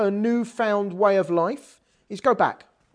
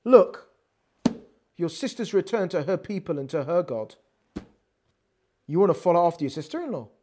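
lower, quick, scornful question; sarcastic condescending; 5 semitones lower, faster than usual. Shown as a demonstration. Teacher reading Naomi's line as a sarcastic taunt. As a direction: sneer it as a taunt. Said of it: "it's almost condescending and sarcastic".

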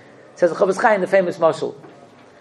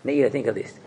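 ordinary delivery

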